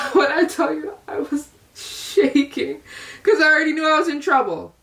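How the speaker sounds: high, giggly retelling of being scared; nervous laughter while telling the story; laughing through it. Real, not acted. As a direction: laugh at your own past panic.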